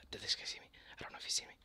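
hushed whisper so he won't notice; tense whisper, not to be seen. Real, not acted. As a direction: breathe it, hiding. Hiding from enemy player.

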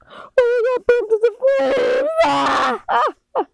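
panicked screams. Acted. Characters burned in flames.

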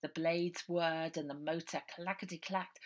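ordinary delivery, nothing marked

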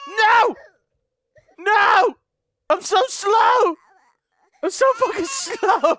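high, loud panicked yelling; panicked shout. Real, not acted. Gamer fleeing the monster, panicked shout. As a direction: scream like he's right behind you.

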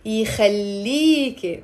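slow يخليك in three parts; three syllables. Shown as a demonstration. Teacher counting syllables, يخليك slowly in three beats. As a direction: draw out the "lee" in يخليك. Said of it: "Three syllables".

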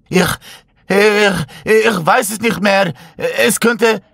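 high, slow frightened stammer; panicked stammer. Acted. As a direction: stumble over the words in fear. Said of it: "ihre Worte überschlugen sich".

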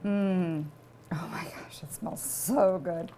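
breathy whisper of delight; savoring a smell. Real, not acted.

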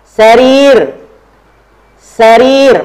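high, loud, drawn-out model word; drill call-out. Shown as a demonstration. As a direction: call it out for the class to repeat. Teacher models word for repeat drill.

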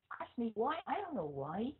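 ordinary talk

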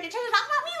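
high mocking imitation of her; mocking whine. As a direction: mimic her needy question. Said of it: "you're like".

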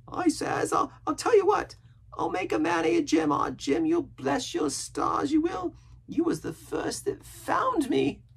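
very high, loud sailor voice; excited boastful castaway. A narrator's reading. Castaway promising to reward Jim, excited.

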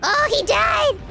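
high, loud exclamation; outburst at a game death. Real, not acted. Streamer reacting to a sudden game death, high dismayed outburst.

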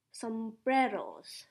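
ordinary talk

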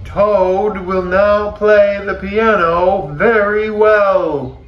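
high, loud stage announcement; strange announcer voice. A narrator's reading. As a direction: announce the act grandly from far away. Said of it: "said the strange voice".

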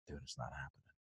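very low, barely audible agent; acted subdued letdown; hushed bad news. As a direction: break it to them low and quiet. Agent breaks bad news by phone.

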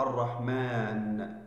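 "الرحمن" drawn out slowly, each syllable held; slow demo word. Shown as a demonstration. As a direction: stretch "الرحمن" slowly so the class hears it. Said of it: "تحذف الألف من كلمة". (نطق "الرحمن" ببطء شديد ومقطعًا مقطعًا)

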